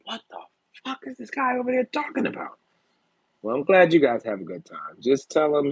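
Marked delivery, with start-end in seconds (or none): 0.1-2.6 high baffled outburst; baffled neighbour. Retold or imitated. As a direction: voice a confused neighbour. Imagined neighbour through the wall.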